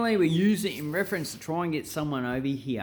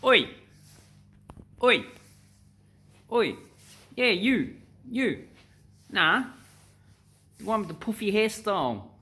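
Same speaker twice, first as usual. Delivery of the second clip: calling out; 7 semitones higher than usual. Retold or imitated. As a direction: rudely summon someone across the room. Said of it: "and they're going".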